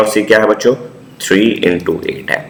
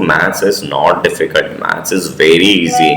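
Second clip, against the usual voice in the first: slow emphatic reassurance; much slower than usual. Real, not acted. Teacher stressing maths is easy.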